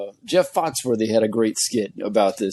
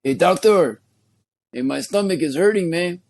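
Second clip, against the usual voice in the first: higher-pitched Mexican vato voice; vato accent; 4 semitones higher than usual. Acted. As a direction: put on a comic Mexican-American homeboy accent. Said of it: "now I'm gonna go full-blown vato".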